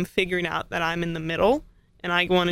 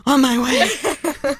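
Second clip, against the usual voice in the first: excited; 8 semitones higher, 8 dB louder, much slower than usual. Retold or imitated. Eager reply in retold scene.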